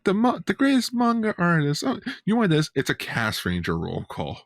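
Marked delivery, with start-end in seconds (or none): 0.0-2.7 mocking voice. Mimicking a manga artist offering.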